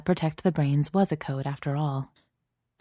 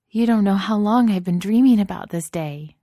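high, swelling, dreamy wistfulness; lost in a long-held daydream; 5 semitones higher, 6 dB louder than usual. A narrator's reading. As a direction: gush it dreamily, raised voice. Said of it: "Andrea said dreamily".

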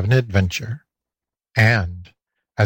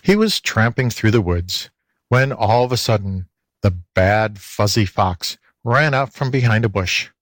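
ordinary delivery

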